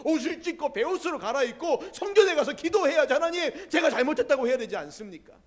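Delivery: loud, high, ringing sermon voice; full-force preaching. Real, not acted. Pastor challenges the congregation about answered prayer, loud preaching. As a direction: preach it out to the whole hall.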